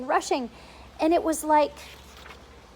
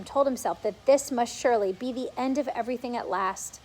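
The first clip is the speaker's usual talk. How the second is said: plain reading voice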